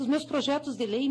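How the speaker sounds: ordinary talk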